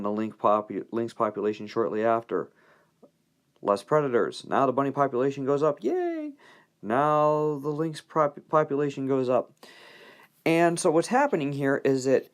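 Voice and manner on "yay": squeaky high mock "yay"; mock cheer. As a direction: cheer it like a delighted kid, way up high. Mock cheer for rising bunny numbers.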